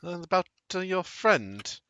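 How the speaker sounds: high, lilting character voice; high-voiced inquisitive woman; curious tea-drinking stranger. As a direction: ask curiously, pitched well up.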